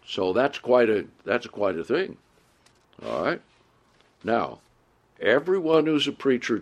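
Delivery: normal talking voice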